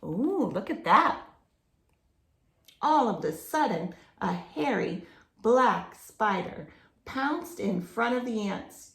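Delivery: ordinary delivery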